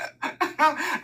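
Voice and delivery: high helpless laugh; real laughter; burst of laughter. Real, not acted. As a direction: crack up at the memory. Laughing at the memory.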